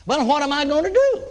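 high, pleading question; helpless plea to the preacher; dismayed plea. Retold or imitated. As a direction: ask like someone suddenly at a loss. Sick man asks preacher, dismayed.

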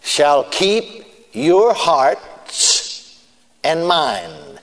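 very slow and deliberate. Acted. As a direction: stretch every word out. Very slow scripture emphasis.

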